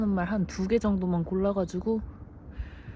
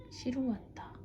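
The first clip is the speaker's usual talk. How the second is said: whispering; 5 semitones higher, 10 dB quieter, slower than usual. Real, not acted. Whispered aside.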